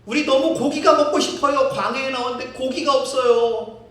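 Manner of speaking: loud, high whining plea; hammed-up loud whining; whining demand. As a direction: beg for meat like spoiled kids. People demand meat.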